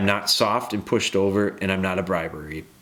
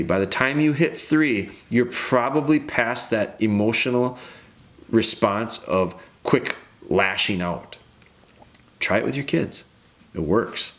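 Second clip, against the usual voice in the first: ordinary talk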